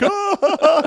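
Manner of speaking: loud, high mock "ah" with laughter; imitated laughing cry. Host retelling a movie scene, loud imitated laughing outburst. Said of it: "and they're like".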